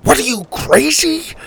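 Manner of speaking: high, stunned outburst; can't believe what she's asking; incredulous. A narrator's reading. Grandpa aghast at Susie.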